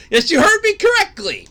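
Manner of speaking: high, loud mock shock; outraged disbelief. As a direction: act stunned at the price.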